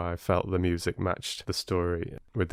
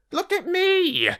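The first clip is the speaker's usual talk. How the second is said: high rat voice crying out look at me; a show-off rat demanding attention; boastful rat. Acted. Rat shows off.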